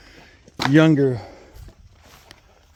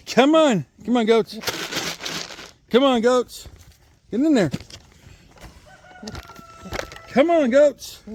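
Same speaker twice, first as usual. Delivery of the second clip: high-pitched, coaxing livestock call; calling animals in; 8 semitones higher than usual. Real, not acted. Farmer urging goats to get in there, calling out. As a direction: pitch it up high to summon the herd.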